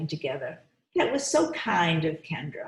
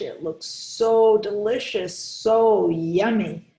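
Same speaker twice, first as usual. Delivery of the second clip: savouring; 3 semitones higher, 5 dB louder, slower than usual. Pretend. Teacher savours the food for kids.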